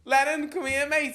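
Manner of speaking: calling out. Retold or imitated. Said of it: "And I shout".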